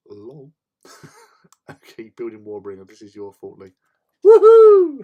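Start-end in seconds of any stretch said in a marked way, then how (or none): 4.2-5.0 high, loud whoop of delight; real whoop of joy; loud cheer. Real, not acted. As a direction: let out a big celebratory woohoo. Cheering a teammate's build.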